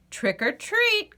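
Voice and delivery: high, slow trick-or-treat call; Duck voice. Acted. Duck greets the squirrel, high and slow. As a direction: sing it out like an eager duck. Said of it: "quacked Duck".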